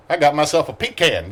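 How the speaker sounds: Southern twang, laughing through the line; laughing Southern mock. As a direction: tease the player in a hammed-up Southern accent.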